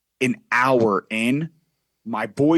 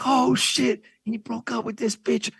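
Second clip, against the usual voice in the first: put-on much higher, hyped Dominican accent from Brooklyn; 11 semitones higher than usual. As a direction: gamer buddies losing it in a Dominican accent. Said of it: "this whole Dominican accent".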